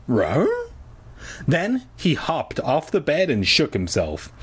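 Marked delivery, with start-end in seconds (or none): 0.1-0.5 short doggy "ruh" with rising tune; dog asking a question; questioning dog bark. A narrator's reading. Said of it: "as if asking a question".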